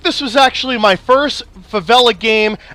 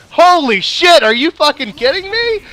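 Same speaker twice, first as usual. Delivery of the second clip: higher and louder, thrilled shock; excited disbelief; 7 semitones higher, 3 dB louder than usual. Retold or imitated. Finding front-row tickets, thrilled disbelief. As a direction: blurt it like you can't believe your luck.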